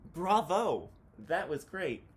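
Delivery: loud, high cheering calls; crowd cheering. Acted. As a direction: cheer for an encore.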